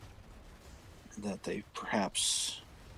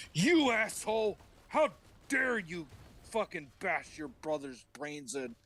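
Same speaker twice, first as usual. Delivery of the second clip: angry God; 9 semitones higher than usual. Acted. God scolding Cain for the killing, mock fury. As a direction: yell in mock divine outrage. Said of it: "and God's like".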